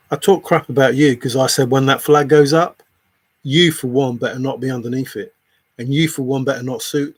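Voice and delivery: loud, heated telling-off; angry rebuke. Real, not acted. Streamer rebuking a viewer over the flag, loud and angry.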